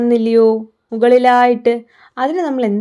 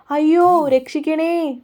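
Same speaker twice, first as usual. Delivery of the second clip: cry for help; 5 semitones higher than usual. A narrator's reading. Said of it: "തവളയും നിലവിളിച്ചു".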